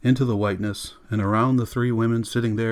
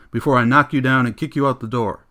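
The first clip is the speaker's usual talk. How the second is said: threat shouted, louder; yelling; 3 semitones higher, 6 dB louder than usual. Acted. Montague threatening Missus Bowles, loud yell. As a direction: snap the threat at her loudly. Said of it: "he yelled".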